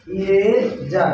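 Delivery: Eraser stretched out very slowly, syllable by syllable; slow drawn-out eraser. Shown as a demonstration. Teacher to pupils: very slow eraser, every sound stretched. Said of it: "আবার যদি বলি".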